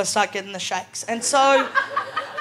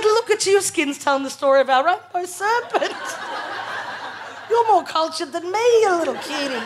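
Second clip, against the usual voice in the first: much higher, teasing through laughter; laughing tease; 7 semitones higher than usual. Retold or imitated. Teasing her ginger boyfriend about his freckles, laughing. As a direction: giggle it out while teasing him.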